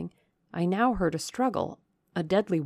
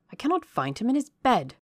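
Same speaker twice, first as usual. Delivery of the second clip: quick, higher-pitched alarm; agitated cry; 7 semitones higher, 4 dB louder, faster than usual. A narrator's reading. Colonel Dent reporting Rochester missing from bed, agitated cry. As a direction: blurt it out fast and flustered. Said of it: "cried Colonel Dent".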